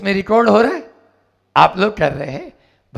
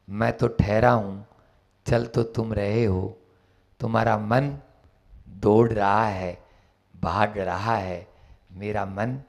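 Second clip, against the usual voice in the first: serene calm; 9 semitones lower, 9 dB quieter than usual. Acted. The Buddha gently answering the bandit, serene. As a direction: speak with deep inner calm.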